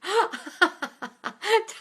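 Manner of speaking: a real high burst of laughter; high delighted laugh. Real, not acted. Host cracks up at the singing dog, real laugh.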